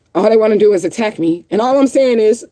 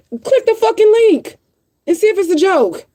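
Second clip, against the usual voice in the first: exasperated high snap; 12 semitones higher than usual. Real, not acted. Vlogger yelling at commenters to click the link, exasperated.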